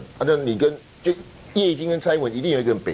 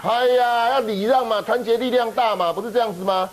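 sarcastic; 8 semitones higher than usual. Acted. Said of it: "辉文这个是反讽哈".

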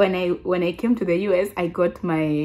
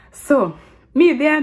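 usual speaking voice

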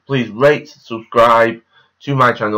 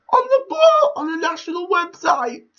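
very high-pitched, squealing with excitement; high, excited put-on squeal; 5 dB louder than usual. Real, not acted. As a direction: squeal it up high like a proud cheer. Excited boast about national website.

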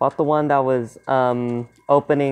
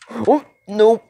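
high, alarmed blurt; jumpy and pitched up; startled refusal; 9 semitones higher than usual. Real, not acted. Startled during tense horror game.

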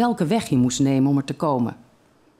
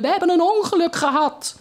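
much higher, excited shout; children shouting; 13 semitones higher than usual. A narrator's reading. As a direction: yell it out as thrilled kids.

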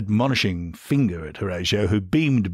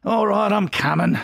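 yelled; 12 semitones higher than usual. A narrator's reading. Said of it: "he yelled".

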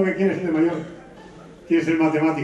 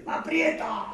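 clenched teeth; 9 semitones higher, 7 dB quieter, much slower than usual. Retold or imitated. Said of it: "¿Por qué hablas así?".

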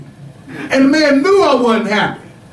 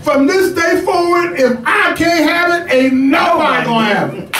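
hammed-up angry outburst; mock furious shout; 5 semitones higher than usual. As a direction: yell it like a hungry man denied his fish, half joking. Voicing Jesus cursing the tree.

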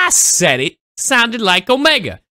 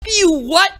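stunned disbelief; 9 semitones higher than usual. Real, not acted. Reacting to the Hokage rap, stunned high disbelief. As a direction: voice jumps high in shock.

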